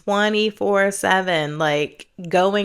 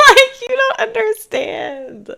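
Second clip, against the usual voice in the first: laughing; 7 dB louder than usual. Real, not acted. Laughing while insisting to viewers.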